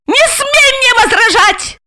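very high squealed command; shriek. A narrator's reading. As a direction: squeal it to forestall any objection. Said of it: "Ася взвизгнула". (визгливо, очень высоко)

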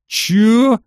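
loud high bellow; roaring. A narrator's reading. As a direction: roar it back in challenge. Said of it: "заревел он в ответ".

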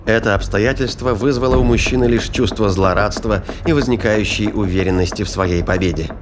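ordinary delivery

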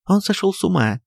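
high, panicked shout; terrified wife calling out; frightened cry. Acted. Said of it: "кричала Софья Антоновна, дрожа от страха". (кричит высоко, дрожа от страха)

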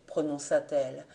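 ordinary delivery

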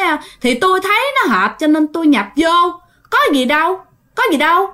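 high, offhand spirit voice; unbothered spirit. Retold or imitated. Spirit casually explaining to the crowd, unbothered and high. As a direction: shrug it off coolly. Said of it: "tỉnh bơ vậy đó".

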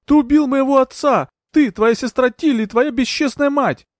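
very high, loud tirade; furious high-pitched outburst, last words drawn out; screamed accusation. A narrator's reading. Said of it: "закричала в ответ Маргарет".

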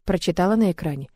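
ordinary delivery

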